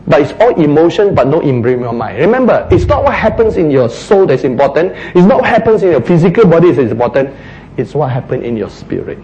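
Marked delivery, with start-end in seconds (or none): none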